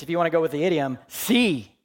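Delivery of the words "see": higher, louder one-word order; a sharp loud command to see. Shown as a demonstration. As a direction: bark it as a command. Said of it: "And the command is".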